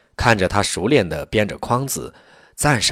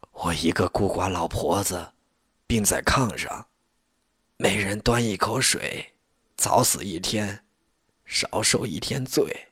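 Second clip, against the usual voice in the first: weary lament; 5 dB quieter than usual. A narrator's reading. Sick lonely old woman complains.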